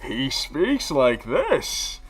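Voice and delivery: a slow, exaggerated Bane delivery; Bane voice demo. Shown as a demonstration. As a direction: speak slowly, enunciating every word. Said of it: "Like that".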